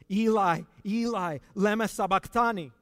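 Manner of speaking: crying out loud in agony from the cross; loud anguished cry. Acted. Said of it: "Jesus cried out in a loud voice".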